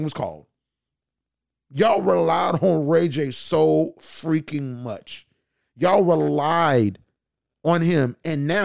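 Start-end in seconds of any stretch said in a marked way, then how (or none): 1.7-7.0 each word slow and deliberate, like scolding a group project; slow emphatic blame. Real, not acted. To the College Hill cast, slow emphatic scolding. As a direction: drag out every word, pressing each one slowly. Blaming the cast for relying on [name].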